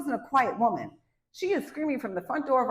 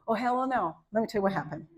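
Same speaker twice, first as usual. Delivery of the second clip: usual speaking voice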